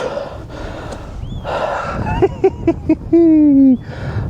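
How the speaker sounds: long, happy laughing; delighted laugh. Real, not acted. Showing the catch.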